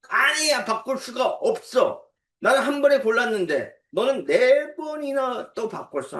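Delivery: high-pitched mock quarrel; imitated angry child. Child refusing to let a sibling change choices, mock-angry insistence. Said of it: "하면서 화를 내는 거예요".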